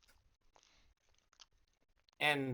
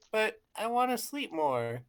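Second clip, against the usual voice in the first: whiny Morphomon; 6 semitones higher than usual. Acted. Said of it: "Morphomon will be like".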